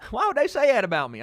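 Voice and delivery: high, quick, wounded dad impression; upset dad. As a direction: replay dad's indignant protest. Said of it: "I know, he was so upset".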